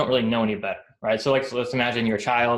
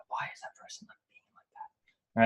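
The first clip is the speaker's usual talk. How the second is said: hushed, voiceless whisper of disbelief; whispered aside; 14 dB quieter than usual. Acted. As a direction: whisper it like a private reaction behind someone's back. Aside reacting to overly nice person.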